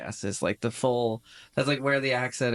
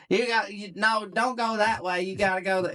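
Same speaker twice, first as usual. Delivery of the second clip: put-on little Southern kid; 7 semitones higher than usual. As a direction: boss them around as a tiny Southerner. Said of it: "little kid talking in a Southern accent".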